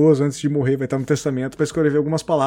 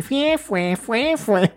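accordion sound imitation; 7 semitones higher, much slower than usual.

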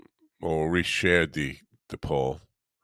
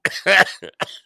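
burst of laughter; 8 dB louder than usual. Real, not acted. Laughs at doggy bag joke.

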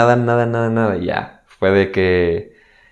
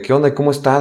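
higher-pitched innocent check-in; innocent, unsuspecting; 6 semitones higher than usual. Retold or imitated. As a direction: ask casually, still unaware of bad news. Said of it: "Yo todavía súper inocente".

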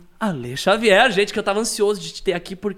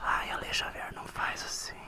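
whisper; 14 dB quieter than usual. Acted. Playful ASMR whisper exchange.

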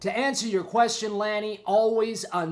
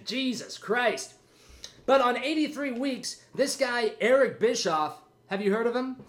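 ordinary talk

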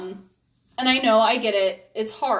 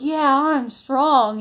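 put-on child voice; 4 semitones higher, 5 dB louder, much slower than usual. Mother voices her little son.

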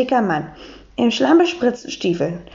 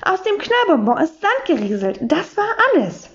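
higher, sneering voice of a sour teacher; mock her with a pinched high tone; 7 semitones higher than usual. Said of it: "hat Frau Sauermann gespottet".

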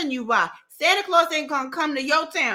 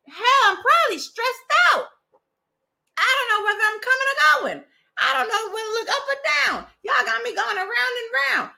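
mock frazzled; 10 semitones higher than usual. Real, not acted. Mocking own stress.

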